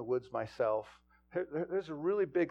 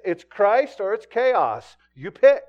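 quoted preacher's challenge; 7 semitones higher, 13 dB louder, slower than usual. Retold or imitated. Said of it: "and he used to say this".

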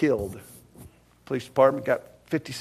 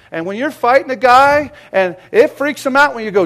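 loud, high preaching voice; shouting sermon fervor; fiery preaching; 9 semitones higher, 13 dB louder than usual. Real, not acted. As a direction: preach the story of courage. Preaching at full force.

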